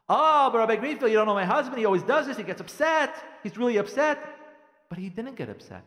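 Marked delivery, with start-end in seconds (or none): 0.1-4.2 high, very loud mock objection; loud protest. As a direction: burst in as an agitated wife objecting. Wife voiced protesting to the rabbi.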